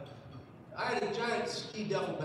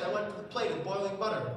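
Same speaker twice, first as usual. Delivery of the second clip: ordinary talk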